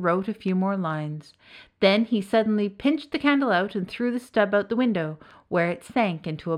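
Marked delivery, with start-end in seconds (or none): none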